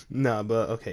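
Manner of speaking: usual speaking voice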